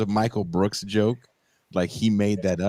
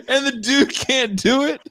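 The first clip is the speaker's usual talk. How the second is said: ordinary talk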